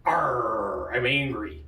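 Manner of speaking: low, growled pirate "arr". Acted. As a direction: grumble it like a comic pirate. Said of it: "talking like a pirate".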